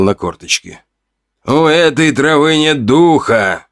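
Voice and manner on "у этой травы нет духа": slow, high-pitched, hesitant statement; uncertain. A narrator's reading. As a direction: say it slowly, unsure, pitch raised. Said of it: "неуверенно произнёс он".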